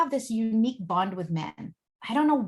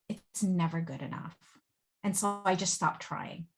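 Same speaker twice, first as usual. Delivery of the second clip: low and soft, a man worn down by years; quiet sad confession; 4 semitones lower, 4 dB quieter than usual. Retold or imitated. Husband quietly tells the therapist, low and defeated. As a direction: let it sink lower, hurt and tired. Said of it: "he said".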